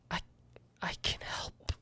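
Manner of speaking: unvoiced, breathless whisper of a plea. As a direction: beg in a frightened whisper. Captured player pleading with spider.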